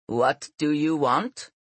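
slow, stern butler's demand. A narrator's reading. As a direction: ask it sternly, drawing the words out. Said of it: "he asked sternly".

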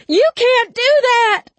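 very high, loud shriek; panicked scream. A narrator's reading. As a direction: scream in frantic protest. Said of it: "Wright screamed".

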